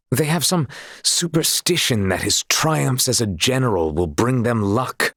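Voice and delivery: ordinary delivery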